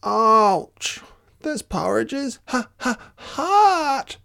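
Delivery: high squeaky little bear voice; a small cub burnt by hot porridge; Baby Bear. Acted. Baby Bear yelps at the hot porridge, high and squeaky. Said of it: "Baby Bear said".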